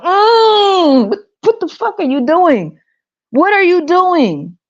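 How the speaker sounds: high, appalled outcry; shocked disbelief. Retold or imitated. Speaker imagining people eating chocolate, high and appalled. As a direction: pitch it way up in horror. Shocked reaction voiced again.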